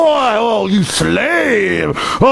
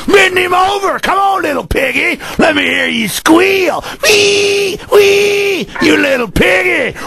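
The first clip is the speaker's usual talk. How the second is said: higher, shouted sneering cop voice; imitating bullying cop; 8 semitones higher than usual. Radio host imitating a bullying cop for callers, shouted sneer. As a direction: bark it like a power-drunk cop lording over citizens. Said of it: "that's an imitation of 'em".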